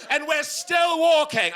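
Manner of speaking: exasperated. Real, not acted. Exasperated disbelief, told.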